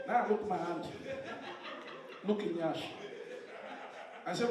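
ordinary talk

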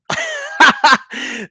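a quick burst of high laughter. Real, not acted. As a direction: crack up at the funny name. Laughs at a username.

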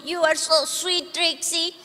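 ordinary talk, nothing marked

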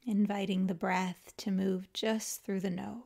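calm, unhurried yoga nidra guidance with long pauses; guided meditation. Acted. Teacher guiding listeners to let the body melt, calm guided meditation. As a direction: ease them into rest, leaving space after each phrase.

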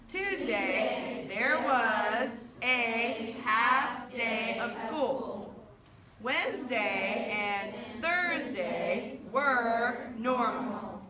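loud, drawn-out, syllable by syllable; slow choral-reading lead. Shown as a demonstration. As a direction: lead a class reading aloud together, very slowly. Leads slow choral reading again.

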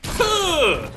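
high, loud outburst of disbelief; what the, shouting in stunned surprise; shocked. Real, not acted. Gamer shocked by an instant tower, loud disbelief.

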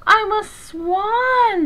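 high, drawn-out joyful exclamation; happy cry. A narrator's reading. Duckling discovering he is a swan, crying happily. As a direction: stretch it out in delighted discovery. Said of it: "the ugly duckling cried happily".

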